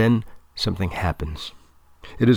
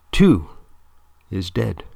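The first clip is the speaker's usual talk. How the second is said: ordinary delivery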